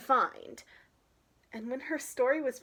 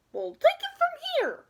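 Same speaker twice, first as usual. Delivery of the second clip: much higher, louder bird chorus; high bird voices; 8 dB louder than usual. Acted. Park birds telling Harriet they'll fly her, high bird voices. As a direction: chirp it like city pigeons. Pigeon friends speak.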